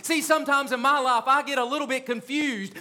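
rising, urgent pulpit preaching. Acted. Pastor urging the congregation about their lions, preaching. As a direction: drive the message home to the pews.

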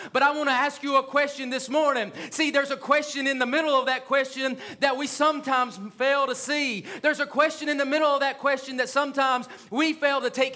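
rising, urgent pulpit preaching. Acted. Pastor urging the congregation about their lions, preaching. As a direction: drive the message home to the pews.

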